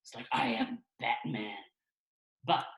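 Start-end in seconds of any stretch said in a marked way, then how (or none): 0.3-1.5 slow, serious superhero growl; dead-serious masked hero introducing himself; Batman. Shown as a demonstration. Showing why heroes sound serious, slow superhero voice. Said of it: "can you imagine Batman?".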